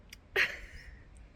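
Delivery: breathy laugh through tears; teary laugh. Real, not acted. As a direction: laugh while welling up. Crying-laugh after emotional scene.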